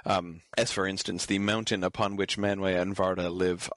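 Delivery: ordinary talk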